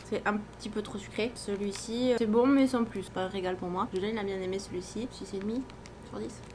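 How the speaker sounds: ordinary delivery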